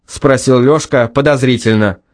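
ordinary delivery, nothing marked

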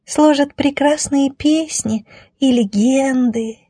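high, slow, dreamy piping; thin voice stretched out wistfully; dreamy little voice. Acted. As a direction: drift off softly into a high reverie. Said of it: "мечтательно добавил всё тот же голосок".

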